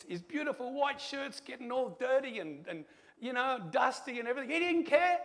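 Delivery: rising to a high, loud outburst; impassioned testimony. Real, not acted. Preacher telling of Ezekiel holding the dirty boy, impassioned. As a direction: build with emotion, pitch climbing to the last words.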